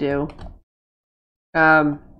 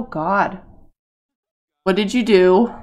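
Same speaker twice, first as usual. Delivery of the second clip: ordinary talk, nothing marked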